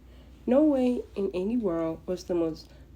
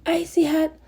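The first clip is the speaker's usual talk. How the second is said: much higher and louder, a furious shout; explosive booming yell; 9 semitones higher, 7 dB louder than usual. Acted. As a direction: blast it through the door at them. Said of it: "explosive voice booms through the door".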